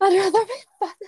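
laughing while echoing the line; laughing repeat. Retold or imitated. Echoing her friend's quote, laughing. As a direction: giggle through it, high and loud. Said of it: "that accent was flawless".

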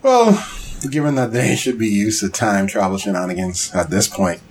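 usual speaking voice

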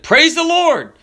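high-pitched rider's call; calling out a command. Acted. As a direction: sing out a cheerful command to the horse. Rider calls command to horse.

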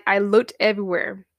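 normal talking voice

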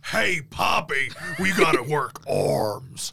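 very slow, hammed-up bodybuilder shout; big bodybuilder. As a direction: stretch it out like a showboating muscleman. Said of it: "that TikTok of the big bodybuilder".

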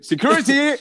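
high, loud call for help; mock shout for security. Brushing off a stranger at the club, mock shout. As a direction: yell it like you need the bouncer.

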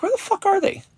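high-pitched exasperated outburst; a sudden high cry of annoyance. Retold or imitated. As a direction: squeak it out in disbelief. Exasperated inner quote at airport.

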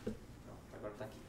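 faint, distant off-mic voice; talking from across the room; far from mic. Real, not acted. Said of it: "Tá onde?".

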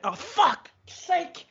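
high, very slow drawn-out exasperation; hammed-up fed-up catchphrase; mock exasperation. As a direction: stretch it into a staged wail of frustration. Acting the technical mishap catchphrase.

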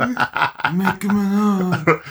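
slow, lightly voiced mob boss; mock Godfather, drawn out; Don Corleone. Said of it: "Get your Don Corleone on".

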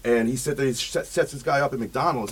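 ordinary talk

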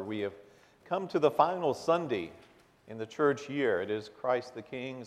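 usual speaking voice

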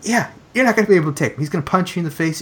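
lecturing a guy who wants to fight, fast and insistent; emphatic fast warning. Retold or imitated. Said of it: "I'm like".

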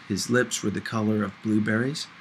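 usual speaking voice

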